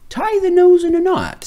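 high, thoughtful thinker voice; high, clever thinker. Acted. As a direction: propose an idea smugly. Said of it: "suggested one thinker".

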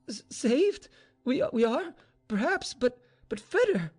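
high, halting voice of distress; distraught faltering. Acted. Grieving mistress thinks of her lover, distraught and halting. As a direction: stammer in anguished disbelief.

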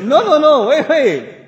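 high, loud, hurried placating; urgent placating. Retold or imitated. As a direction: cut in loudly to calm someone down. Placating an angry challenger, retold.

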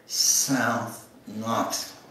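ordinary talk

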